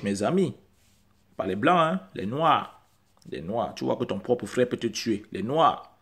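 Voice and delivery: ordinary talk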